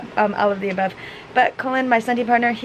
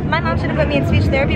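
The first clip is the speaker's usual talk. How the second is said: normal talking voice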